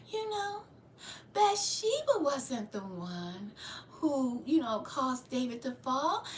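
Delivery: exaggeratedly gentle and soft-spoken, mocking the request; fake soft-spoken demeanor. Shown as a demonstration. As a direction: put on a sweet, hushed demeanor for show. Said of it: "You want me to talk like that?".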